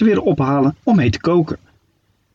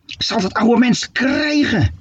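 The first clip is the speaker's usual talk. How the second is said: spiteful witch thought; 8 semitones higher, slower than usual. Acted. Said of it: "dacht ze".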